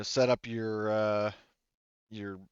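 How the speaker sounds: ordinary delivery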